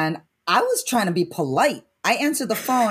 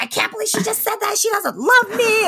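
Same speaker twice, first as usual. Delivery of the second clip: mom's crying rant; 12 semitones higher than usual. Retold or imitated. Mom complaining to the brother, high crying shout. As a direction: wail it like a hurt mother, voice cracking. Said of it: "She got fucking pissed".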